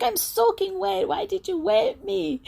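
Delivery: high-pitched mock indignation; mock soaked complaint. Splashed beachgoer protesting at the splasher, high mock outrage. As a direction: squeak out the splashed person's protest. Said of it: "And you're like".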